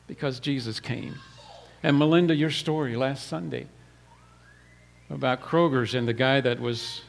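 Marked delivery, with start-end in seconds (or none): none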